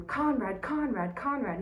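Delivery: a family member calling the name across a hallway, high and urgent. Retold or imitated. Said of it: "are yelling at him".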